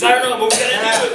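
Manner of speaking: high, loud, rushed imitation; insistent retort. Partner insisting on a risky film, mock urgent. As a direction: blurt it out loudly, mock urgent. Acted urgent reply in retold exchange.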